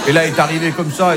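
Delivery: ordinary delivery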